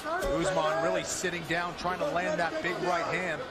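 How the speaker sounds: ordinary talk, nothing marked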